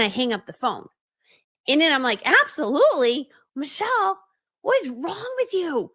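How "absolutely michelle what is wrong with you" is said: high, incredulous mock scolding; appalled self-scolding. Scolding herself after hanging up, comically appalled. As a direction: berate yourself, stretching out the words in disbelief.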